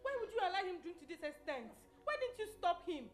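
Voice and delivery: high, accusing questions; scolding. Acted. Scolding the friend who let Andrew drink, high and accusing. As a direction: take the friend to task.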